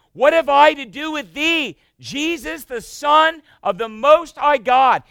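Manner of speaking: high, loud anguished cry. Acted. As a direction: cry it out to Jesus at full voice. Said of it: "he cries with a loud voice".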